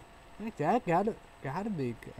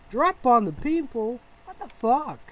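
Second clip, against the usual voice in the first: high, loud disbelieving yell; outraged shout; 10 semitones higher, 7 dB louder than usual. Real, not acted. Ranting about bombs on civilians, outraged shout. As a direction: cry out in outrage at the bombing.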